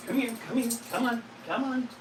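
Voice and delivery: high, soft coaxing pet talk. Real, not acted. To the dog, coaxing her over: high soft coo.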